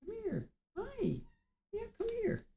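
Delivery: high, soft coaxing voice for a pet; calling the cat. Real, not acted. Host coaxing her cat Sid to come, high soft calling. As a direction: sweet-talk the cat over, way up high.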